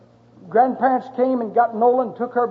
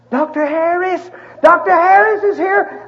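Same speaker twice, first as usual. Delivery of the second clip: calling across the room, higher; nurse calling out; 8 semitones higher than usual. Retold or imitated. Nurse hollering that the doctor has arrived, calling out.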